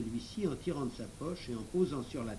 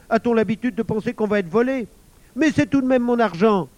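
put-on high, loud, indignant boy; raised, aggrieved voice; exasperated protest; 9 semitones higher, 12 dB louder than usual. A narrator's reading. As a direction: insist against unfair questioning. Émile exasperated, insists on his money.

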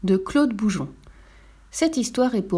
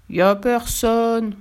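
grumbling voice; 2 semitones higher, 3 dB louder, much slower than usual. A narrator's reading. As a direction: grumble it out slowly from far below. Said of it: "grogna une voix venue du fond du trou".

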